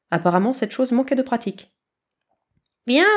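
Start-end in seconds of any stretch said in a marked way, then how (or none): 2.9-3.0 calling chick. Acted. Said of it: "répéta la gourmandise volante".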